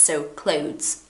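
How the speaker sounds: Clothes with a very soft T and S; barely touch the T and S in Clothes. Shown as a demonstration. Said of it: "a very soft T and S".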